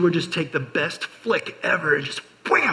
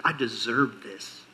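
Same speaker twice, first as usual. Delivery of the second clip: smug entitlement; 6 semitones lower, 7 dB quieter than usual. Retold or imitated. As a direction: savor your own entitlement.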